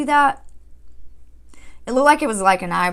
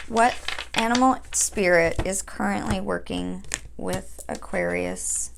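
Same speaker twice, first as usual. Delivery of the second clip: invocation; 2 semitones lower, 4 dB quieter than usual. Acted. Calls on spirit guides slowly.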